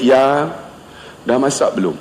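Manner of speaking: low, gentle husband voice; soft sweet talk. Shown as a demonstration. Husband home asking wife about cooking, soft sweet talk. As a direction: ask tenderly, lowering the voice. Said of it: "Saya cakap lembut".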